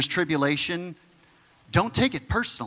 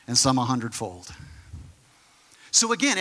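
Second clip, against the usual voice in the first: ordinary delivery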